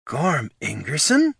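slow, high-pitched disbelieving question; astonished gasp. A narrator's reading. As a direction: gasp the name in surprise. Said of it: "she gasped".

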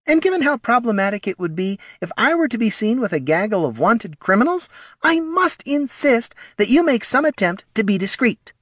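very high, prissy little voice; fussy, superior small kobold; haughty kobold Morty. Acted. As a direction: lecture primly in a tiny high voice.

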